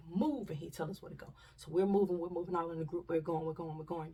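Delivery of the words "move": sharp order. Retold or imitated. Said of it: "So he's like".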